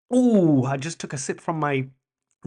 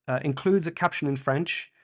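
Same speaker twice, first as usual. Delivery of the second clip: ordinary talk, nothing marked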